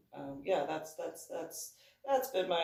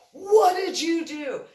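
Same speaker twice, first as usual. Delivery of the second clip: much higher, louder panicked question; alarmed ride worker; 13 semitones higher, 14 dB louder than usual. Retold or imitated. Ride worker running up to them, alarmed shout. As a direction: demand it in alarm. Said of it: "he's like".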